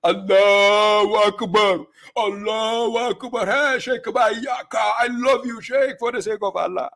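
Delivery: high, slow, hammed-up fan cry; mock adoring crowd. Mocking the preacher's audience with a gushing, hammed-up cheer. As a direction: mimic a gushing follower loud and high. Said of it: "Then the audience will be".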